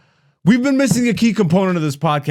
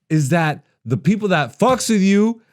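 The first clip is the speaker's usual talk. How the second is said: ordinary talk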